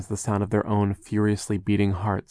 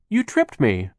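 raised, accusing tone; lightly acted loud accusation; protesting; 6 semitones higher, 5 dB louder, slower than usual. Klaus accuses the foreman of tripping him, protesting.

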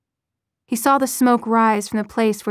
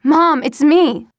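loud, slow, pleading shout; loud pleading call to his mother; 5 semitones higher, 9 dB louder, slower than usual. Acted. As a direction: drag it out, yelling through the wood. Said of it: "he shouted".

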